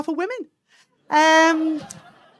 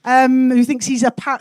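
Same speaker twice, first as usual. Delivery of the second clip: ordinary talk, nothing marked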